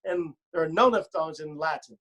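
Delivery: ordinary talk, nothing marked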